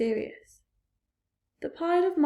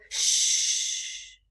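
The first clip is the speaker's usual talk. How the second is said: sharp breathy shh; whispered shush. A narrator's reading. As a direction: hush him at once. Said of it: "the others whispered".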